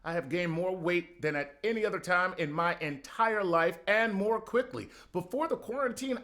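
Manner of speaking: usual speaking voice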